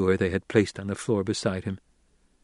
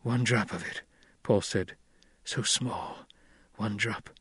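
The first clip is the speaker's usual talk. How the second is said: weak murmur; 8 dB quieter than usual. A narrator's reading. Weak Paul just woken from coma.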